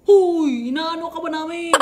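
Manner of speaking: loud, high imitation of a telling-off; hammed-up indignant rebuke; mock scolding. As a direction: call out "huy" like an annoyed scolder. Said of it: "pinagalitan na ako".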